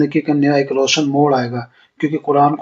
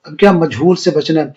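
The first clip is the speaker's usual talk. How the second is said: ordinary delivery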